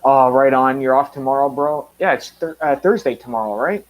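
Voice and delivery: ordinary talk, nothing marked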